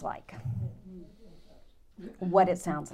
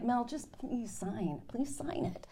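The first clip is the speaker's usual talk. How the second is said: hushed pleading; 4 semitones higher, 9 dB quieter, slower than usual. Retold or imitated. Fuming husband begging her.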